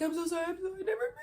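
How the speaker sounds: high, climbing mock sobbed apology; imitated toddler breakdown cry. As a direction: mimic him bawling like a toddler. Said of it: "toddler breakdown cry".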